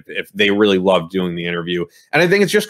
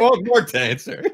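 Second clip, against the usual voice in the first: laughing; 12 semitones higher than usual. Retold or imitated. Teasing co-host, laughing through quote.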